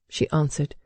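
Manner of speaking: ordinary delivery, nothing marked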